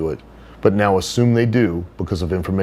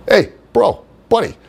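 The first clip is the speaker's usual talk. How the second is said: high, loud dopey bro voice; Johnny Canine's voice; 11 semitones higher, 4 dB louder than usual. Retold or imitated. Johnny greeting Henry, in a high loud bro voice. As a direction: pitch way up and push it out like a meathead buddy. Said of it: "that's how he would talk".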